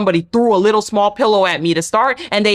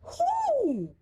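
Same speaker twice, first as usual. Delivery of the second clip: shocked squeak; 12 dB quieter than usual. Retold or imitated. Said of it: "And I was like".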